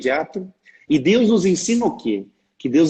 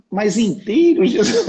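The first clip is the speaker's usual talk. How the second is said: much higher, louder squeal of disbelief; mock disbelief; 6 semitones higher, 3 dB louder, slower than usual. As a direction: gasp it as if Jesus can't mean all of it. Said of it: "foi muito engraçado".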